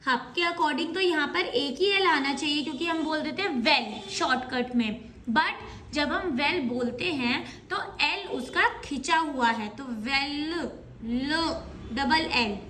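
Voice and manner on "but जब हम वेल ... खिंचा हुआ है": normal talking voice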